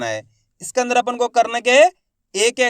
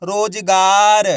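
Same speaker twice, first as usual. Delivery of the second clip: रोजगार said very slowly and louder; slow blended word; 5 dB louder, much slower than usual. Shown as a demonstration. Teacher blends रोजगार for pupils, very slow. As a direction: glide the syllables into one slow word. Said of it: "तो मिलके बन जाएगा".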